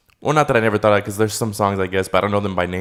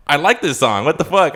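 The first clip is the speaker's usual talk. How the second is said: surprised; 7 semitones higher, 5 dB louder than usual. Retold or imitated. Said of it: "and I'm like".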